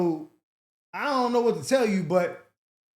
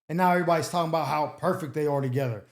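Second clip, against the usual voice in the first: usual speaking voice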